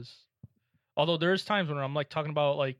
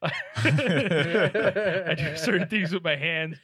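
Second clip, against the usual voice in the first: laughing; 3 semitones higher, 8 dB louder, much slower than usual. Real, not acted. Reading item while laughing.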